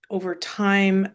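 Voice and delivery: usual speaking voice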